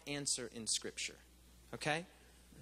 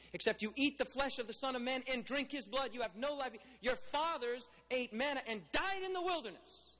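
Jesus's words pushed higher and harder; preacher voicing Jesus to the crowd, intensified; 7 semitones higher than usual. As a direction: press it home with rising force. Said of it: "he intensified what he said".

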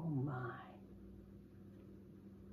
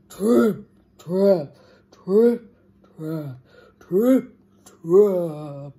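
loud, separated stomping words; heavy hoofbeats; 8 dB louder, much slower than usual. A narrator's reading. Biggest goat tramps over the bridge, heavy stomping. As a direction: stomp each word out like heavy hooves.

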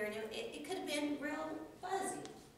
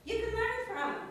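self-encouragement; 10 semitones higher, 4 dB louder than usual. Retold or imitated. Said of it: "And I was like".

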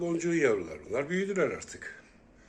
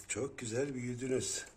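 normal talking voice

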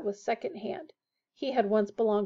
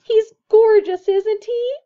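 high, much louder adoring gush; glowing; 11 semitones higher, 14 dB louder, slower than usual. A narrator's reading. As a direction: gush over the cat, delighted.

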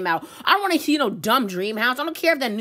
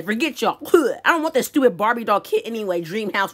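normal talking voice

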